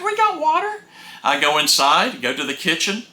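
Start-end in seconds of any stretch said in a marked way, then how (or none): 0.0-0.8 very high, puzzled exclamation; incredulous. Retold or imitated. Husband checking the gauge, shrill high disbelief. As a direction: squeak it out in disbelief.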